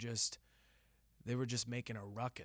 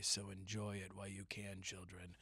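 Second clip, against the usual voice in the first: normal talking voice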